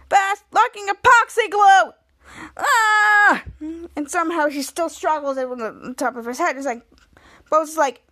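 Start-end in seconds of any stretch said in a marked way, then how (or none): 0.1-3.4 loud, high horrified build to a scream; hammed-up horror; retold outrage. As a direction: shout it out, ending in a yell. Acting out Ray's horrified discovery.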